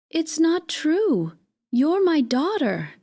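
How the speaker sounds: understated desperate cry. A narrator's reading. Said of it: "I cried".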